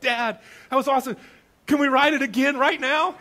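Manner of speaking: put-on boy begging to ride again; excited kid. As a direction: beg like a thrilled child. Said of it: "first word out of his mouth".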